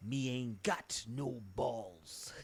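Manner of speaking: put-on slow, hammy Jamaican accent; imitating a bad Jamaican accent; fake Jamaican accent. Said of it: "the fake Jamaican accent".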